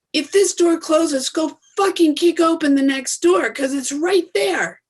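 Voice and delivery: impassioned, rising motivational call; rally the listener hard; fired up. Real, not acted. Fired-up motivational pep talk.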